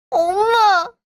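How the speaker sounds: high sobbing cry for mom. Acted. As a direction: break down crying. Hurt daughter sobbing for mother.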